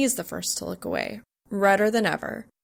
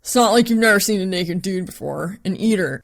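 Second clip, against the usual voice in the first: teasing roommate; 6 dB louder than usual. Acted.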